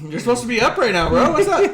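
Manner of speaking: high, loud, fast ribbing; hammed-up frustrated bro; mock exasperated yell. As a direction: taunt the stalled partner. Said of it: "She's like".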